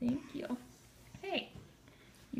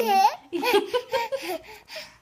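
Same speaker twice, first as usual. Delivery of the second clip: high laugh; 9 semitones higher, 11 dB louder than usual. Real, not acted. Mom laughing hard.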